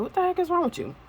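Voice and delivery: a hint of a startled dad, voice jumping up; worried father. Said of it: "He comes and he's like".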